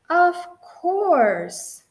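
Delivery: a high, loud burst of certainty; eager agreement. Acted. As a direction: jump at the idea. Husband agrees enthusiastically.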